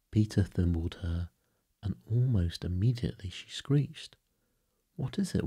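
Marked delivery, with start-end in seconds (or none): 5.0-5.5 higher-pitched, concerned query; concerned question. A narrator's reading. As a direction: ask with sudden concern, pitched up. Peter alarmed by Wendy's screech.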